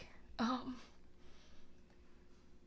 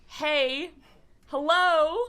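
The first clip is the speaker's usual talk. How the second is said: loud, higher call to the guys; raised-voice hail; yelling for attention; 6 semitones higher, 8 dB louder, much slower than usual. Acted. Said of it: "maybe yell at the guys".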